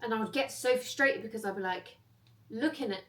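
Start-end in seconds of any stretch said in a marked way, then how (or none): none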